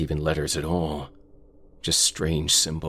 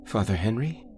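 higher, rising unsure call; puzzled question; 7 semitones higher than usual. Acted. Sam calls after the ignoring priest, puzzled question. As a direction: ask it as he walks past you.

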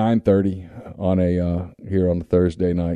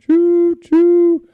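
very high, loud train whistle; 6 dB louder than usual. Retold or imitated. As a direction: blow it like a steam whistle. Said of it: "he pulls it up".

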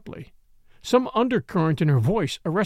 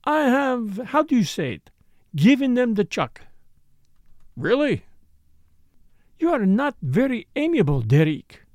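put-on lightly French-accented, higher and coquettish; Frenchwoman; 5 semitones higher than usual. A narrator's reading. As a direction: tease him with a French lilt. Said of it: "Morel chides Derek".

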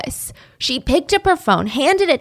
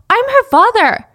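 higher, louder indignant put-on voice; mock Frank; 11 semitones higher, 6 dB louder than usual. Frank in jail insisting to police, mock indignant. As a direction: scoff it like a smug liar. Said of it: "he said".

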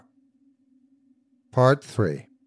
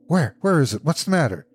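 startled, higher-pitched questions; alarmed; 6 semitones higher, 4 dB louder, faster than usual. A narrator's reading. Husband started awake by his wife's cry, alarmed. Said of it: "He started up in alarm".

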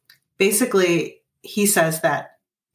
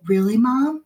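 put-on higher, brightened-up child asking back; surprised kid; 6 semitones higher, slower than usual. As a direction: ask like a daughter who suddenly feels seen, pitched up. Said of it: "she's gonna be like".

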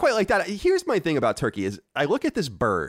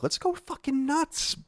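excited; slower than usual. Real, not acted. Rallying for Italian Thanksgiving.

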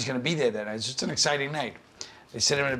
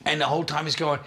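ordinary delivery

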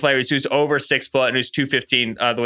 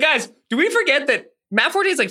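exasperated; 11 semitones higher than usual. Real, not acted. Exasperated rant at guys.

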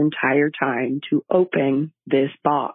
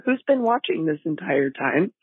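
ordinary delivery

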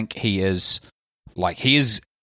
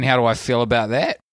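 normal talking voice

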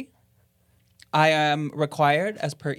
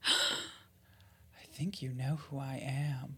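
slow, softer ghostly murmur; slow eerie hush; 10 dB quieter, much slower than usual. Acted. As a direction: breathe it out like a ghost.

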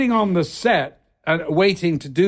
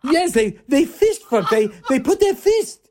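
much higher, louder, gushing Italian producer; gushing Franco; 11 semitones higher, 3 dB louder than usual. Retold or imitated. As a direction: camp it up as Franco, delighted. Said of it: "He goes".

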